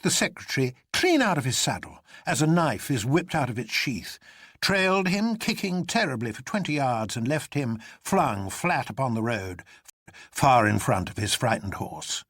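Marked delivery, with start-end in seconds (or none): none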